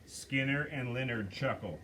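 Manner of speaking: ordinary delivery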